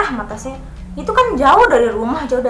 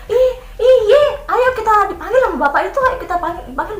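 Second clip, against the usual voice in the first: eager friend; 9 semitones higher than usual. Retold or imitated. Friend eagerly answers the driver.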